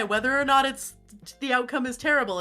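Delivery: ordinary talk, nothing marked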